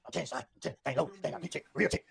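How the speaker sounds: a hint of his friend's way of talking; Roscoe. Retelling what the driver said in the car, lightly imitated. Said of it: "Roscoe talk like this".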